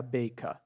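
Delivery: BAKER ending in a near-A, "bay-kah"; final R as A. Shown as a demonstration. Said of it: "vai virar quase um A". (BAKER terminando quase em A)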